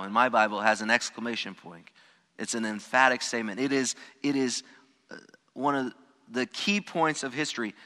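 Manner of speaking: ordinary talk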